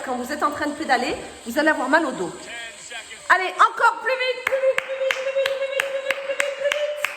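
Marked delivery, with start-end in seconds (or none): 3.3-7.1 high, rapid-fire repeated urging; urgent cheering on. Real, not acted. Coach spurs the class to pedal faster, relentless high urging. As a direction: chant it high and relentless, easing off in volume.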